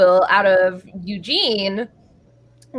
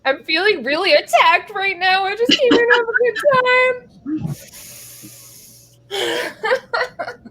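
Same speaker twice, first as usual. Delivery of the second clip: mock whining; 11 semitones higher than usual. Mock hurt after teasing.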